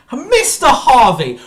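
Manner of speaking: loud, high, slow shout of the name; slow, high, bellowed "Mister Harvey"; drawn-out furious shout. Real, not acted. Furious rant at Brian.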